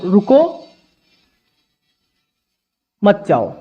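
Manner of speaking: रुको rising, a long pause, then मत जाओ falling together; pause after रुको. Shown as a demonstration. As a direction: break the phrase after रुको. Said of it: "पहला वाक्य है".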